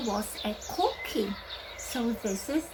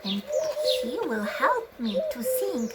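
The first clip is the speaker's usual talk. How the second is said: puppet voice; 10 semitones higher, faster than usual. Acted. Teacher voices puppet friend.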